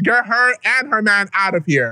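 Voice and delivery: mock order. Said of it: "she's the problem".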